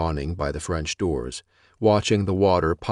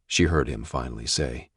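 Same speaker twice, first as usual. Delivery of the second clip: usual speaking voice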